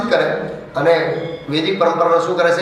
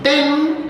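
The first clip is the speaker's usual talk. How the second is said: a high, bright ding like an app notification; imitated phone ding; 9 semitones higher, much slower than usual. Mimicking the delivery app alert, a high ding.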